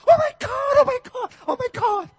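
very high, loud excited squeals. Pretend. Preacher voicing Mary and Elizabeth meeting, excited high squeal. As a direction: squeal like an overjoyed cousin arriving. Women greeting excitedly in story.

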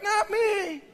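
put-on high squeaky kid refusal; reluctant kids. Kids refuse to wear the jacket, high reluctant voice. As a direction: imitate children backing out in a tiny high voice.

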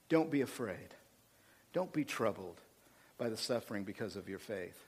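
quiet pastoral comfort after the climax; soft reassurance. Acted. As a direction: ease down to a tender hush. Gentle pastoral reassurance after preaching.

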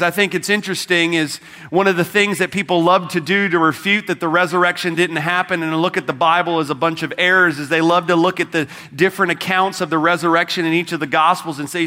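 ordinary talk, nothing marked

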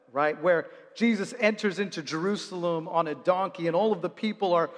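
normal talking voice

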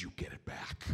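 harsh whispered command; whispered order. Retold or imitated. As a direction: hiss it low like a stern dad. Dad repeats his demand.

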